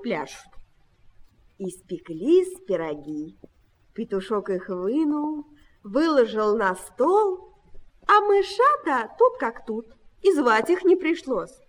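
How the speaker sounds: ordinary delivery, nothing marked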